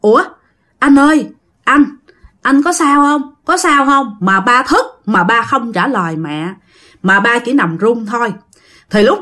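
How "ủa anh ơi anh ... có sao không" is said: higher, alarmed questioning; startled alarm. Acted. Startled wife checking on her trembling husband, alarmed. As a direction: voice the worried wife, pitched up. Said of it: "Thì mẹ mới hết hồn".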